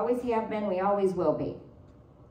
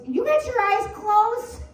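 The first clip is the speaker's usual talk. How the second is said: high, slow, loud startled question; sister's shocked question; 11 semitones higher, 5 dB louder than usual. Retold or imitated. Sister on the phone catching Maria, shocked and loud. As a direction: catch her out in disbelief. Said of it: "she goes".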